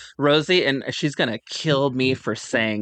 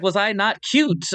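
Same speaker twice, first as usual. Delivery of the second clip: put-on Irish accent; 8 semitones higher, slower than usual. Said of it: "she's like".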